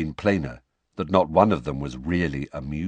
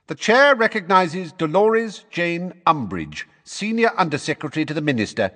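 formal announcement; 10 semitones higher, 5 dB louder than usual. A narrator's reading. Fudge formally introducing Umbridge.

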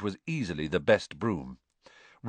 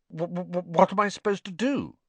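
put-on higher stammering boy, stuck on the first sound; higher nervous stutter; 10 semitones higher than usual. A narrator's reading. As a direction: stumble nervously into the question. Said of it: "he stammered".

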